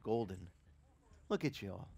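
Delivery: ordinary delivery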